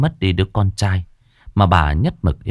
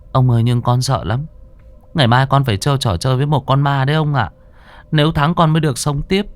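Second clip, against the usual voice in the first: put-on frightened child; 8 semitones higher than usual. A narrator's reading. Frightened boy pleading.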